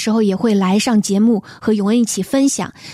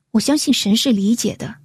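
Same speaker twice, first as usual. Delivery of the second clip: usual speaking voice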